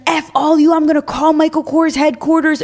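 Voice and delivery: a hammed-up furious customer, pitched high; mock angry yell. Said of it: "He starts freaking out".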